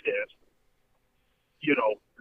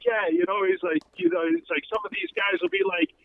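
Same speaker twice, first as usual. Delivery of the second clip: mild Chris Rock bit; 11 semitones higher than usual. Retold or imitated. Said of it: "He was like".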